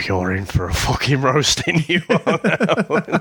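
teasing warning that breaks into a laugh; giggling you're in for it. Retold or imitated. Imitated reaction, laughing.

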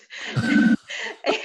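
high breathless laugh bursting out; burst of laughter. Real, not acted. Guest recalling her grudging deal with God, laughing hard. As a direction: laugh out loud at yourself.

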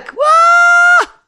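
high, drawn-out demonstrated wail; wordless cry. Shown as a demonstration. Teacher demonstrates crying out without saying anything, long high wail. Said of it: "צועק".

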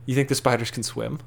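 hushed whisper. Real, not acted. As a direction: keep it to a whisper. Replies in the same hush.

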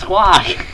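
high loud parrot squawk. Pretend. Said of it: "the parrot made the right sound".

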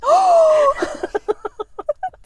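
high loud gasp of delight; mock gasp. Real, not acted. Friend gasping at a new TikTok joke. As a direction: gasp as at a great idea.

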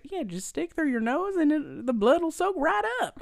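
high-pitched, put-on Southern twang; weird Southern accent. [name] explains tampons for nosebleeds, hammed-up Southern accent. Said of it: "like, the weirdest accent I've ever".

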